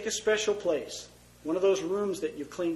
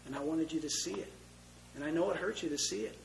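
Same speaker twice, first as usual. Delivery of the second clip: tearful tenderness; 8 dB quieter than usual. Acted. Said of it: "the tears are coming down".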